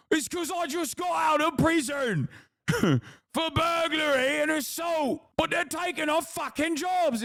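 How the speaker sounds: high mock British yob voice; mock EDL lad. Mocking the EDL guy's answer.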